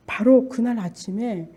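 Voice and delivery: usual speaking voice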